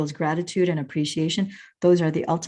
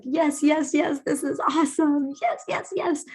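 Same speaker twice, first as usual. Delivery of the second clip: high, thrilled yeses; pitched-up gleeful cheering; excited yes; 9 semitones higher than usual. Pretend. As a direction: cheer like you just got the gift. Excited self quoted in memory.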